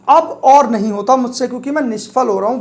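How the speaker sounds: usual speaking voice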